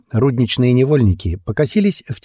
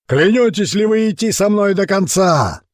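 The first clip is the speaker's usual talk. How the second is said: booming oath call; 11 semitones higher than usual. A narrator's reading. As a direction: demand the oath from the crowd. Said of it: "Его голос грохотал над лагерем".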